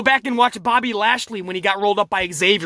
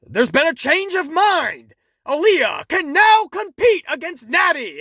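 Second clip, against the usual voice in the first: higher, drawn-out announcer proclamation; imitated ring announcer; 6 semitones higher than usual. Reviewer mimicking the announcer's change of decision, mock proclamation. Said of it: "now the announcer is like".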